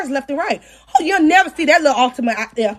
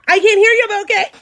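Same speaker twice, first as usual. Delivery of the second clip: mocking taunt; 6 semitones higher, 3 dB louder, faster than usual. Mocking the other woman who went silent, high teasing taunt. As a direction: goad her for not answering.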